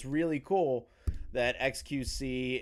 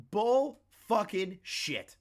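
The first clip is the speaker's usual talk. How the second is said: slow, higher-pitched, each word separated; indignant outburst; 7 semitones higher, much slower than usual. Real, not acted. Streamer rejecting the scammer's excuse, indignant.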